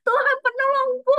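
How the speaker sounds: very high, loud, frantic shriek for help; panicked scream to God. Retold or imitated. On the roller coaster, terrified, screaming loudly for God's help. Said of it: "Jadi tapi aku ngomongnya gitu".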